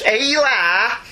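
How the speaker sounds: quick hammed-up Scouse hello; Scouse greeting. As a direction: toss it off like a Liverpudlian mate. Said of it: "[name]'s accent isn't that hard Scouse".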